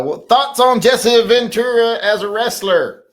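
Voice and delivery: loud, high, slow read-out; comment read-out. Acted. Host reading a viewer's question, loud high read-out voice.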